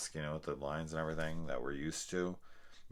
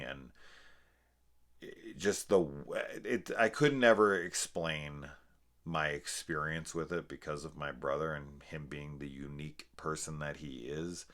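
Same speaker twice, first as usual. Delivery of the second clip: usual speaking voice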